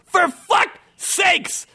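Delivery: very high, slow, stretched-out yell; hammed-up furious critter yelling each word apart; exasperated yell. A narrator's reading. Merc monk disturbed by screams, yelling in exasperation. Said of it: "yelled the merc monk".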